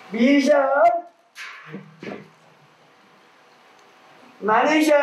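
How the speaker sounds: high, far-carrying call. Acted. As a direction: call out across a distance. Calling out names from the well.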